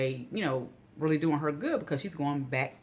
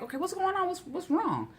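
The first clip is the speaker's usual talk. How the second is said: high, worried what's wrong; rising alarm at seeing her cry; alarmed question. Retold or imitated. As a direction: ask in sudden concern, pitched way up. Finds sister crying, asks.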